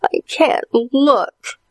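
whimpering. Acted. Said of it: "whimpered his sister Allison".

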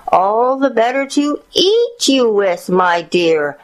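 high, swelling storybook howl; hungry wolf's rising howl, not shouted. A narrator's reading. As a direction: howl it at your prey, dropping on the last words. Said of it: "howled the wolf".

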